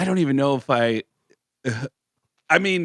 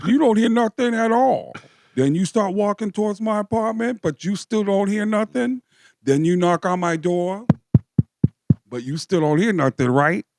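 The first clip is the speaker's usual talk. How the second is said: higher mock-stoned storyteller voice; imitating Tracy; 8 semitones higher than usual. Telling his buddy about the party, higher put-on voice. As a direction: play the friend spinning a wild tale, pitched up. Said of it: "he just went".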